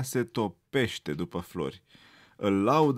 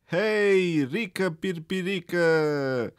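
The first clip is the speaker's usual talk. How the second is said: loud teasing shout, higher; mocking call; 8 semitones higher, 4 dB louder, much slower than usual. A narrator's reading. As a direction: call out to mock the small rabbit. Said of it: "îl strigă colegul său".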